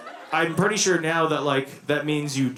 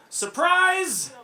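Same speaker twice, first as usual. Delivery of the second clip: retold yell. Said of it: "she yells".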